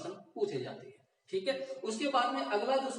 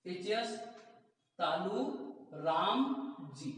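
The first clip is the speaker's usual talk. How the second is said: word by word; 3 semitones lower, 4 dB quieter, slower than usual. Shown as a demonstration. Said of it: "teacher breaks sutra into words".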